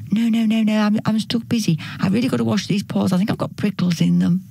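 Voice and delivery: quick, tumbling excuse; flustered busy cat. Acted. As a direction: rattle off the refusal without stopping. Fussy cat refuses, busy washing.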